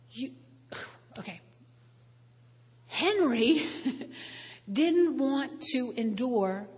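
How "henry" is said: normal talking voice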